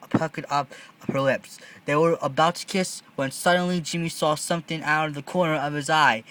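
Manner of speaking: ordinary delivery, nothing marked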